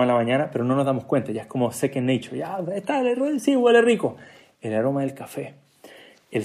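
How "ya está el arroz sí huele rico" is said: high-pitched offhand aside; put-on blasé voice, high; mock casual reaction. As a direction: toss it off as routine, pitched well up. Mimics taking a smell for granted.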